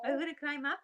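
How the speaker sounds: high sing-song pet talk. Real, not acted. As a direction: coo it up at the piglet. Cooing at the piglet.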